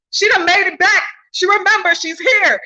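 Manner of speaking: high, loud jubilant welcome; joyous spirit voices. Retold or imitated. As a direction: cry it out like rejoicing spirits. Spirits greeting her in vision.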